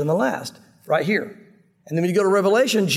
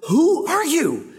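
awed question; 8 semitones higher, much slower than usual. Retold or imitated. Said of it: "I was meditating on that yesterday going".

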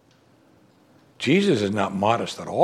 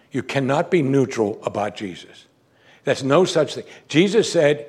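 usual speaking voice

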